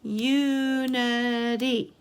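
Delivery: Unity stretched slowly over three beats; slow, measured Unity for counting; syllable by syllable. Shown as a demonstration. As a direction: draw out each syllable of Unity. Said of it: "Let's count the syllables".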